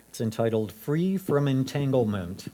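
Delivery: ordinary talk, nothing marked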